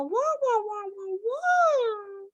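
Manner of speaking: high, drawn-out mocking wahs; motherese babble. Shown as a demonstration. Mocking adults who talk down to kids, motherese babble. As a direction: coo in exaggerated high baby-talk. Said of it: "It's called Mother Ease".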